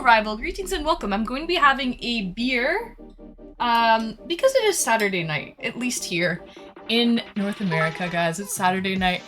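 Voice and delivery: normal talking voice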